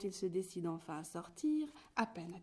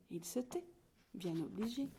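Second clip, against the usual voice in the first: usual speaking voice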